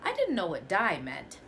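ordinary delivery, nothing marked

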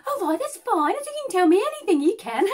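high, fast, eager gush; bubbly date rushing to reassure him, high and fast. Retold or imitated.

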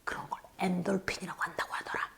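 fast, soft unvoiced whisper; whispered aside. Real, not acted. Teacher confiding to children about endorphins, whispered. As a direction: tell it like a secret.

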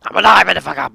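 very loud, explosive yell; triumphant shout. Pretend. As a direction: shout it out at full volume. Game character bursts back alive.

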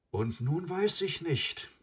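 high, drawn-out helpless sigh of words; slow down and lift it high, at a loss. A narrator's reading. Mowgli is unsure after seeing the girl, sighing. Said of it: "sagte er mit einem Seufzer".

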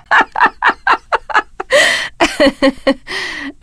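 long, high laughing fit; laughter. Acted. Character laughing heartily at a joke, long high laugh. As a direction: laugh at length, high and staccato.

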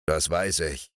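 low, grumbling growl; low sleepy ogre growling "Das weiß ich". Acted. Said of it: "knurrte der Menschenfresser".

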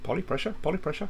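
high, squeaky mock parrot questioning. Jokey parrot-like repeat of the name.

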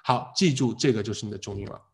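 ordinary talk, nothing marked

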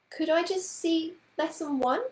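put-on high, rising childlike request; pleading little-girl question; hopeful child. A narrator's reading. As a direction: ask sweetly for a small favour. Child asks a hopeful favour.

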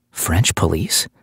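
usual speaking voice

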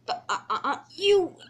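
broken stammer ending in a huff; stammer, lost for words; sputtering. Acted. Derailed engine blames Percy, sputtering in exasperation.

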